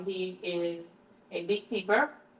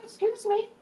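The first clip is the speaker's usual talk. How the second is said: high, sing-song mock offense; high playful excuse-you scolding; mock prissy scold; 5 dB louder than usual. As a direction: tease the burper with fake primness.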